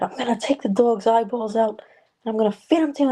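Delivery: hammed-up threat to scared kids; mean daycare worker. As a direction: play the cruel caretaker threatening the kids. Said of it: "She'd be like".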